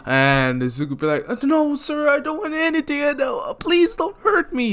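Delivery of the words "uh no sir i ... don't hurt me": very high, squeaky begging; hammed-up scared kid voice; pleading frightened boy. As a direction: plead in a terrified falsetto. Boy begs mugger.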